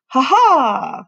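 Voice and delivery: high hearty ha ha; hearty laugh. Acted. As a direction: laugh it out loud and bright. Professor tries mounting the bike.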